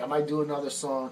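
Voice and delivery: usual speaking voice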